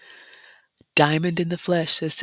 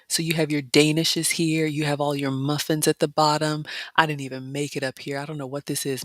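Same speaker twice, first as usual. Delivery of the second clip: normal talking voice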